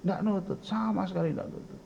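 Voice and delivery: normal talking voice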